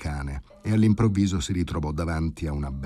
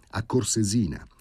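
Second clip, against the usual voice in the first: ordinary delivery, nothing marked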